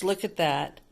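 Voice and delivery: plain reading voice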